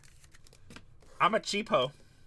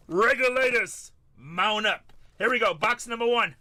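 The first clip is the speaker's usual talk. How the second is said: hype catchphrase; 6 semitones higher, 6 dB louder than usual. Acted. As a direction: call the crew to ride out. Kicking off box opening with catchphrase.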